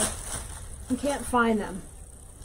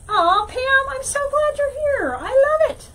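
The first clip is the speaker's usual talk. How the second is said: gushing greeting; 10 semitones higher, 4 dB louder than usual. Real, not acted. Greeting viewer Pam warmly.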